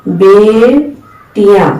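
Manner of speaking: बेटियां drawn out syllable by syllable; slow careful बेटियां; slow model word. Shown as a demonstration. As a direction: stretch बेटियां, be-ti-yaan. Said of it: "जैसे".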